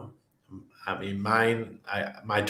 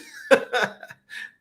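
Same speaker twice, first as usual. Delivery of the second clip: laughing. Real, not acted. Laughing after retelling the insult.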